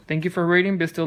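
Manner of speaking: ordinary delivery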